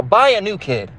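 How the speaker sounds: lightly voiced mocking aside; mock rich parent. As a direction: toss it off sarcastically.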